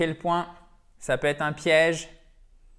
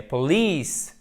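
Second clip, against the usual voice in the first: police slow, stressed on the second syllable, long i; 3 dB louder than usual. Shown as a demonstration. English teacher demonstrating the stress of police, slow. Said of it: "On a accent tonique".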